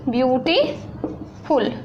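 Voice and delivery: ब्यूटीफुल drawn out slowly as ब्यू-टी-फुल; slow syllable split. Shown as a demonstration. Teacher demonstrates three syllables in ब्यूटीफुल. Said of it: "आप बोलिए ब्यूटीफुल".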